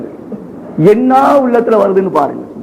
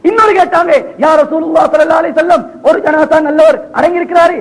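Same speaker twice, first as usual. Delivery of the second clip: high, ringing sermon voice; impassioned preaching; 7 semitones higher than usual. Acted. Preacher relaying companions' question to the Prophet, impassioned. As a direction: lift the question high and declaim it.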